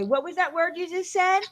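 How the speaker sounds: high-pitched teasing question; mocking girls. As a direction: imitate girls poking fun at an accent. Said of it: "used to make so much fun of me".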